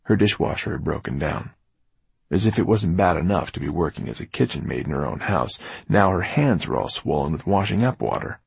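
plain reading voice

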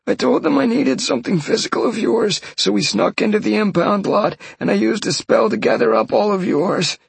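high, hurried voice of a scared man; babbling captive. Acted. Mage explaining to Sean how they got his blood, high and babbling.

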